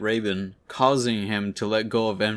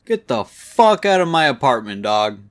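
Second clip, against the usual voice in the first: loud angry shout, not full force; a mock furious yell at an intruder; retold yell; 2 semitones higher, 8 dB louder than usual. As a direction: bark the order out. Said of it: "and yells".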